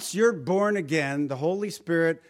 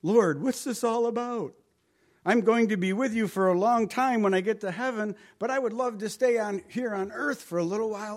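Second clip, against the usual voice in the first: David's plea; 6 semitones higher than usual. Retold or imitated. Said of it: "he says".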